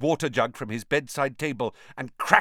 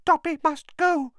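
breathy house elf; 4 dB louder than usual. Acted. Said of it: "breathed the elf, terrified".

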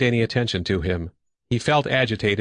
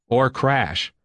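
plain reading voice

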